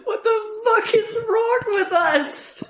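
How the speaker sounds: high cracked wail; anguished wail. Real, not acted. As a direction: wail in mock despair. Crying out in despair.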